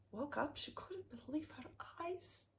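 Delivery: hushed whisper of amazement. A narrator's reading. Narrator marvels at the gold-filled room, whispering. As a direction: breathe it out in a soft whisper.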